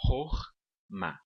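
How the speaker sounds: "Horma" split as hor, then ma; two separate syllables. Shown as a demonstration. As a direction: say "Horma" in two beats with a pause between. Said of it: "nós temos duas sílabas".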